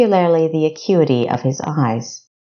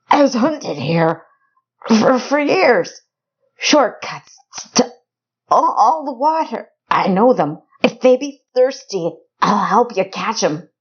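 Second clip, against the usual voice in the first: blurting rustic informant; 7 semitones higher than usual. Acted. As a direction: blurt it out to save your skin. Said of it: "when Robert blurted".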